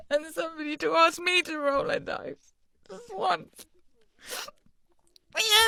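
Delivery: high, whiny voice on the edge of tears; high-pitched pity-party whine; mock-tearful self-pity. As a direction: feel sorry for yourself, trailing to a tiny whimper. Alone in booth, losing it.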